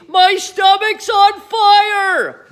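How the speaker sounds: high, slow, stretched-out howl; shouting. A narrator's reading. Grandma howls at George about her stomach, slow shout. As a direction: wail it as if your insides burn. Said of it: "she shouted suddenly".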